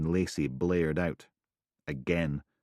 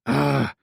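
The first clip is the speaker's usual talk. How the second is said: loud exasperated ugh; frustrated groan; 9 semitones higher, 7 dB louder than usual. Acted. Steele groaning at Tufty, loud and fed up. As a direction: let it all out in a groan.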